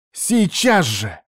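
high, slow, insistent demand; furious command. A narrator's reading. Niece demands her uncle leave, furious. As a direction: stretch the order out angrily. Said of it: "прошипела та".

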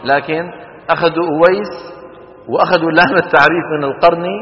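normal talking voice

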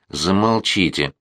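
ordinary delivery

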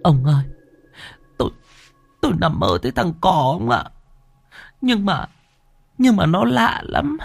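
wife tearfully tells husband of the dream, high and frightened; frightened wife sobbing. A narrator's reading. Wife wakes husband after nightmare, high frightened sobbing. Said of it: "vừa ôm mặt khóc tu tu".

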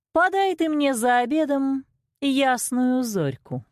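high, imperious ogress order; ogress queen. A narrator's reading. Old queen orders the cook to serve a child, ogress tone. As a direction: command it like a hungry ogress. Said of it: "произнесла она это тоном людоедки".

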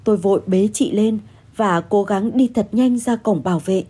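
ordinary delivery, nothing marked

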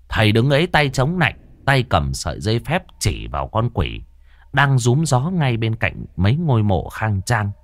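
plain reading voice

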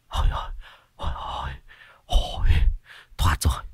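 hổn hển thì thào, hụt hơi; thở dốc nói thều thào; thở phì phì. A narrator's reading. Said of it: "thở phì phì". (thở phì phì, thì thào hụt hơi)

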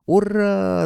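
long, high hurrah; jubilant shout. A narrator's reading. Friends yell in chorus at their success, jubilant. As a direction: cheer out at full pitch, stretching it. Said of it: "заорали мы хором".

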